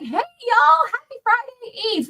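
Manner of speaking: hammed-up sunny opener to viewers; a mock-perky host greeting the audience. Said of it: "I was so perky".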